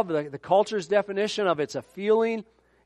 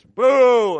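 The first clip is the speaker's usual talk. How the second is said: long, loud, high booing; 6 semitones higher, 10 dB louder than usual. Retold or imitated. Preacher reacting aloud to the book, booing loudly. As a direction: heckle it with a drawn-out boo. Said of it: "He's booing an article".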